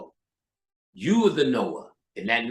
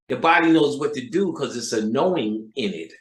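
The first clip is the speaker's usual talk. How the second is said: normal talking voice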